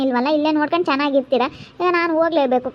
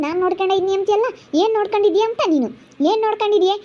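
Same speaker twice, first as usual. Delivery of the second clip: angry retort; 5 semitones higher than usual. Acted. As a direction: challenge her claim, voice rising in anger. Family quarrel, mocking her claim. (ಏರುತ್ತಿರುವ ಸಿಟ್ಟಿನ ದನಿ)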